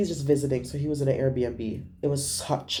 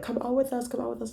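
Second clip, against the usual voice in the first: coaxing friends; 6 semitones higher, 5 dB quieter than usual. Retold or imitated. Coaxing Arno to go out.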